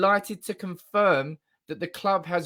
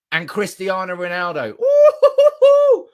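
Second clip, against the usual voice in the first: ecstatic shout; 2 semitones higher, 10 dB louder than usual. Real, not acted. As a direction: let out a wild victory whoop. Celebrating the signing wildly.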